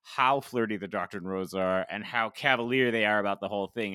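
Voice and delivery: ordinary talk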